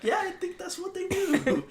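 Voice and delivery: high, far sarcastic yeah; mock agreement. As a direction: play along in a squeaky mocking voice. Mocking agreement to angry customer.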